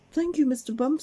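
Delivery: high, grateful thanks; smiling thanks. Acted. Miss Brave thanking Mister Bump after the zip wire, smiling. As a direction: thank him with a smile. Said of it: "smiled Miss Brave".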